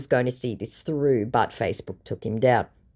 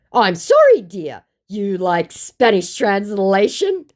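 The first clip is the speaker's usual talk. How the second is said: high, slow mock-polite scammer; hammed-up apology and offer; put-on scammer voice; 6 semitones higher, 4 dB louder, slower than usual. Voicing the second scammer.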